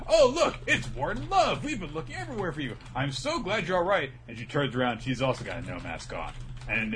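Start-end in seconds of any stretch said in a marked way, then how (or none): 0.1-4.1 high, gushing mock relief; hammed-up high glad greeting; relieved high voice. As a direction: squeal it out with relief. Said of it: "they're like".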